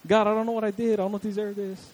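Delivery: hammed-up complaint blaming God; imitating someone blaming God for their troubles; mock blaming. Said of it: "just blaming God for everything".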